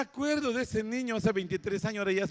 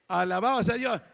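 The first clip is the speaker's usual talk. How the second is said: ordinary talk, nothing marked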